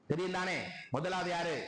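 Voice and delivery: normal talking voice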